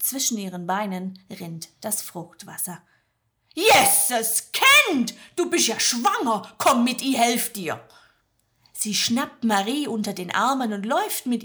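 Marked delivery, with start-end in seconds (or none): 3.5-7.9 startled Magda. Acted. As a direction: burst out in alarm then help. Magda sees the pregnant woman. (Magda erschrickt, laut schwäbisch)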